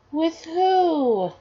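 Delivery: put-on high little-kid question; child's voice. Curious son asking his parent, in a high child's voice. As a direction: pitch it up like a small child.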